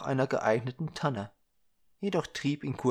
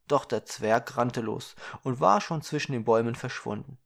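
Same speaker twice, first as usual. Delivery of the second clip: usual speaking voice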